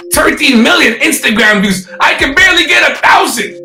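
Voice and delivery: loud, high hype-man announcing; hyped introduction. Real, not acted. Host introducing a viral skater to the audience, hyped. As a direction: raise it to a near shout with amazement.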